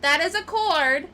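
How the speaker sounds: high, loud mock outrage at the cat; scolding yell at cat. Real, not acted. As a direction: shout the cat off the cord. Yelling at cat over cord.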